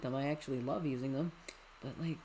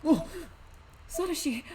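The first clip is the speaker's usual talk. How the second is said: high, loud cry of a name; alarmed cry; 10 semitones higher, 4 dB louder than usual. Acted. Game character cries out.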